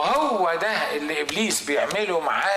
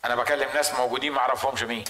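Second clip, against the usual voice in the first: usual speaking voice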